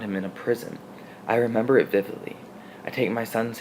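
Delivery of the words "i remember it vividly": usual speaking voice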